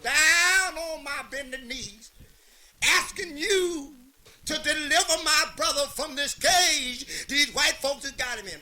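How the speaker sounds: slow, loud, high-pitched preaching; fervent preacher prayer. Acted. A Southern preacher on his knees praying, slow loud preaching.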